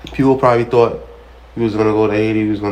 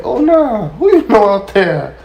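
higher, louder, slow put-on voice; mock surprised lady; 11 semitones higher, 5 dB louder, slower than usual. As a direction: draw it out like she's stunned. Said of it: "I was like".

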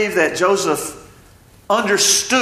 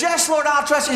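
eager reply; 9 semitones higher than usual. Retold or imitated. Man on cliff answers God.

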